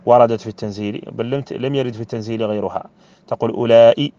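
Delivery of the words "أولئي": "أولئي" with long aa and a sounded glottal stop before i; with hamza. Shown as a demonstration. Teacher showing the Quranic form, "أولئي" with final hamza. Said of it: "بالهمزة".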